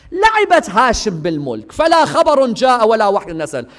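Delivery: rattled off very fast in Arabic; gloating poem recital. Retold or imitated. Yazid gloating in rapid Arabic verse. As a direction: race through the verses like a boast.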